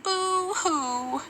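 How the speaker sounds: put-on a soft high whimper of girls crying. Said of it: "Then all the girls cried".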